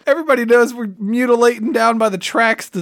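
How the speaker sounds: ordinary talk, nothing marked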